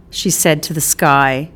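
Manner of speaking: ordinary delivery, nothing marked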